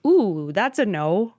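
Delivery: a hint of a sing-song inner voice; voicing her body. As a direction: play her gut talking back, brushing it off. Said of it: "My body was like".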